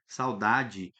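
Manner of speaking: saudade said ending in an I sound, saw-DA-jee; saudade with final I. Shown as a demonstration. Teacher to class, showing the e sounds like I: spoken clearly. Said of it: "pronuncia ele como I".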